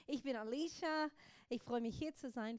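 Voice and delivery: ordinary talk, nothing marked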